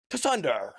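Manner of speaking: "Tsunder" with a T before the S, falling at the end. Shown as a demonstration. Streamer tries the word for chat, "Tsunder" with the T. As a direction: try "Tsunder" with its T in front. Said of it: "do you say the T".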